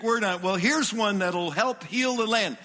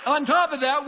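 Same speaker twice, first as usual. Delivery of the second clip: preaching shout; 7 semitones higher, 4 dB louder than usual. Real, not acted. Preacher building to a climax.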